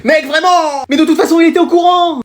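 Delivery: high, loud outburst; exasperated protest. Acted. Pupil to confessing accomplice, exasperated protest.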